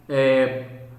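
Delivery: normal talking voice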